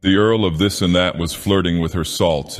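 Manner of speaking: ordinary delivery, nothing marked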